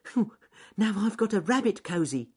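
high, cosy little voice; rabbit character, contented. Acted. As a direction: puff and chirp with satisfaction.

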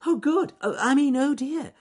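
very high, loud flustered rabbit; bunny caught out, piping and flustered; dithering. A narrator's reading. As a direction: squeak and hastily correct yourself. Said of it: "dithered Ernest Fluffy Rabbit".

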